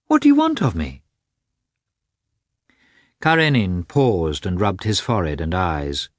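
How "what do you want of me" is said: much higher, louder, wide-eyed innocent surprise; comical surprise. A narrator's reading. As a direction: play the innocent, sincerely astonished. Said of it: "in a tone of comical surprise and sincerity".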